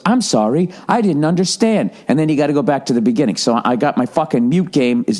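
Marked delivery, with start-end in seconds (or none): 0.1-1.9 polite robot phone voice. Retold or imitated. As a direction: say it like an automated system. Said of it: "the robot goes".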